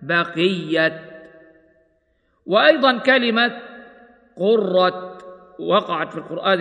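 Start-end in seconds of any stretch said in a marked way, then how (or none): 0.0-1.0 بقية stopped with a clear final t, baqiat; pausal form with ta. Shown as a demonstration. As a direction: end بقية on a crisp t. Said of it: "ويوقف عليها بالتاء هكذا".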